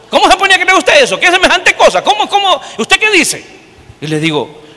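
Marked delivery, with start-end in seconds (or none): 0.1-3.4 high, fast, mocking disbelief; sneering villager, pitched up and rushed; incredulous scoffing. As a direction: scoff at the whole idea.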